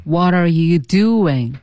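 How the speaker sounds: slow, drawn-out model reading; slow repeat-after-me drill; slow demo. Shown as a demonstration. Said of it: "我们再说几遍".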